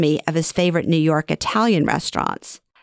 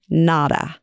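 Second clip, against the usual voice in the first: ordinary delivery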